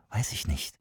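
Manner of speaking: hushed, voiceless answer; very soft whispered reply. A narrator's reading. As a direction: whisper it back. Secret talk, whispered answer.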